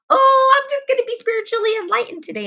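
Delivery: high, breezy mock-spiritual voice; high, airy and dismissive. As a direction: parody someone who thinks enlightenment is instant. Mocking a shallow spiritual attitude.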